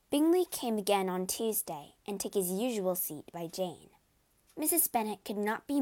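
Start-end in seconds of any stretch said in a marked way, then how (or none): none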